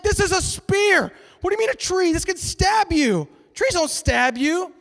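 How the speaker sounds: high-pitched, drawn-out protest; indignant arguing. Acted. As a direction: play an incredulous man correcting his friend. Second blind man insists it's a spear.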